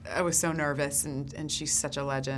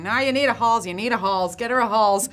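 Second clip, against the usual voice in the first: put-on louder, higher insistent old lady; mock bossy insistence, louder; insistent Debbie; 6 semitones higher, 9 dB louder than usual. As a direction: push the Halls on her, loud and bossy. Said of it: "And she kept going".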